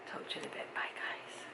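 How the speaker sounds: soft whispered goodbye; whispered sign-off. Real, not acted. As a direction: whisper your farewell to the viewers. Signing off softly.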